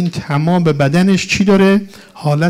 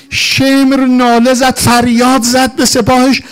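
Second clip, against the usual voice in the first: high, loud, tearful lamentation; anguished martyrdom narration, crying out; wailing rowzeh; 8 semitones higher, 7 dB louder, slower than usual. Real, not acted. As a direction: preach at full force through weeping. Loud mourning narration continues.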